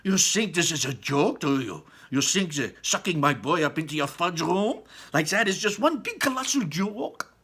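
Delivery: high, loud accusing voice; high loud furious mother; enraged mother. Acted. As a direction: rage at him. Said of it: "mother enraged at Wonka".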